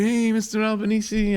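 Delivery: high, slow, stretched-out hello; singsong high call of greeting; drawn-out greeting. Retold or imitated. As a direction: imitate a kid hailing a friend's dad. Kid greets the father he robbed.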